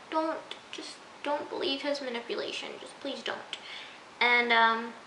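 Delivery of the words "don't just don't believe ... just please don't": hushed pleading. Retold or imitated. Viewer pleading softly with Arya on screen, hushed. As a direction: drop to a soft, half-whispered plea.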